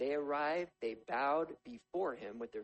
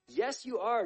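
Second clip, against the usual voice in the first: higher, louder insistence; insisting; 9 semitones higher, 8 dB louder, slower than usual. A narrator's reading. Joseph insisting to his brothers, louder. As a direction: shut down their denial. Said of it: "Joseph insisted".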